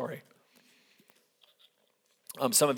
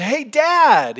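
high, loud mock-bright hello; cheery phone greeting; 10 semitones higher, 9 dB louder than usual. Son calling his dad on the phone, cheery. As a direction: sing out the greeting, louder and higher.

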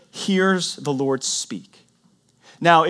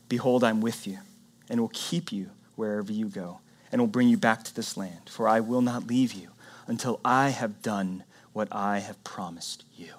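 hushed scripture reading; 8 semitones lower, 8 dB quieter than usual. Acted. Reading God's promise hushed.

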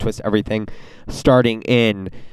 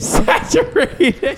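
laughing; 14 semitones higher, much slower than usual. Real, not acted. Mocking the word, laughing.

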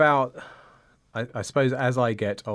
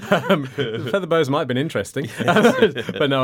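laughing; 3 semitones higher, 7 dB louder than usual. Real, not acted. Laughs at the joke.

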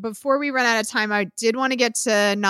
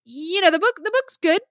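rising tone on good; uptalk; 8 semitones higher than usual. Shown as a demonstration. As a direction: voice lifts at the end. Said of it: "my voice goes up at the end".

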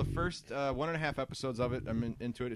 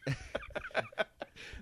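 high laugh; 6 dB quieter than usual. Real, not acted. Reacting to brothers joke.

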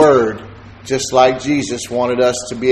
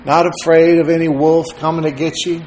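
normal talking voice

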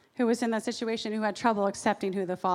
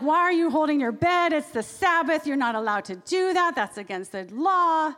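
higher, louder indignant challenge; frustrated accusers; 6 semitones higher, 4 dB louder than usual. Acted. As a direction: scold him like offended officials. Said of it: "They're getting all frustrated".

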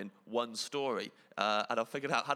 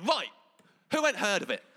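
calling out; 9 semitones higher, 6 dB louder than usual. Retold or imitated. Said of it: "the lady at the front went".

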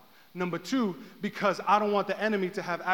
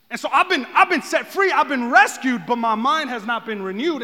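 raised, loud preacher's voice; full-force preaching; 5 semitones higher, 7 dB louder than usual. Real, not acted.

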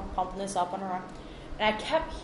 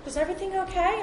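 high-voiced concerned old lady; pitched-up retold concern; imitating older lady; 11 semitones higher, 4 dB louder than usual. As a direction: ask it high, as a worried older woman. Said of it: "She goes".

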